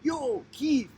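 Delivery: a light, high-pitched hail of a friend; calling out a friend. Retold or imitated. Student Chesky hails his teacher Keith: high, casual call. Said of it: "Chesky says".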